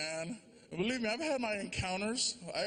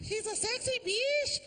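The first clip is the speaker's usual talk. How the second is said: much higher, put-on wife voice; wife's voice. The wife praising her husband, in a high put-on voice. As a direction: purr it like his wife does. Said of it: "His wife calls him that".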